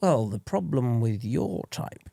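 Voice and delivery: slow, hushed English villain, dropping to a whisper; English villain accent. Pretend. A film villain lecturing Mister Bronzy in a hushed English accent. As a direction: purr it like a menacing English villain. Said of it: "I want either an English accent where it's like".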